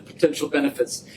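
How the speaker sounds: usual speaking voice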